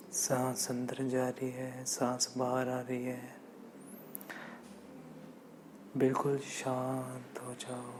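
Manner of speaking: softened, calm meditation voice; guided meditation. Acted. Teacher settling meditators into stillness, soft guided meditation. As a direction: quietly lead listeners into stillness.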